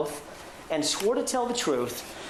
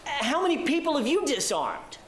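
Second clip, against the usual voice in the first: incredulous; 6 semitones higher than usual. Retold or imitated. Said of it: "in an incredulous tone of voice".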